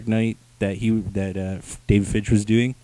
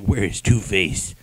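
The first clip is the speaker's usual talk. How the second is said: gruff Batman; 4 semitones lower, slower than usual. Retold or imitated. Batman voiced in retelling.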